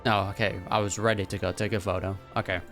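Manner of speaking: ordinary talk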